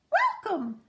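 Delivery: high, squeaky call; a tiny lizard calling out a greeting. Acted. Lizard greeting the dassies from the rocks, squeaky. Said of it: "a squeaky voice called out".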